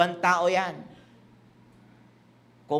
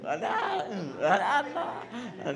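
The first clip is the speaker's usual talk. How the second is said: wrong gentle mother, laughing; 3 dB quieter, much slower than usual. Retold or imitated. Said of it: "yung mali".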